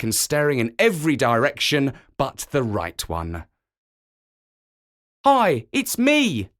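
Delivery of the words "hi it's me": put-on young seal voice. A narrator's reading. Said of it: "said Kotick".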